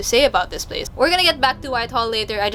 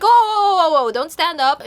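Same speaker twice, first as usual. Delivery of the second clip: high, loud "whoa" easing down; urgent stop-him cry; alarmed warning; 7 semitones higher, 4 dB louder than usual. Retold or imitated. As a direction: stop an injured man from standing. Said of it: "and we're just like".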